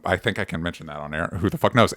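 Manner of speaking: ordinary talk, nothing marked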